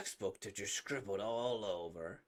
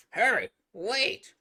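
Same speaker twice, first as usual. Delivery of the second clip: shouting; 8 semitones higher, 13 dB louder, slower than usual. Acted. Calling after.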